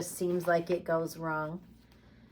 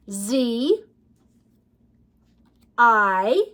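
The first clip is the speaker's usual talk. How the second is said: ordinary talk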